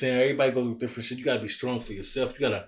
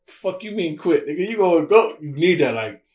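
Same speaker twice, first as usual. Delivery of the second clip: higher, louder, insisting you go; pushy friends; 4 semitones higher, 6 dB louder than usual. Retold or imitated. Friends pushing him not to quit, loud and insistent. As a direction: friends refusing to let him quit, bossy push. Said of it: "They be like".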